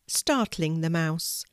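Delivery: ordinary delivery, nothing marked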